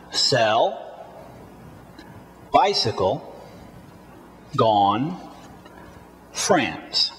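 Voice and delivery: "Sell", "bicycle", "gone" each rising, "France" falling; repeat-after-me drill. Shown as a demonstration. Teacher repeats "Sell, bicycle, gone, France" for learners, word by word. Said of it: "Let's do it one more time".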